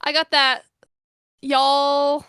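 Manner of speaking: put-on Southern accent. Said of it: "that slat Southern".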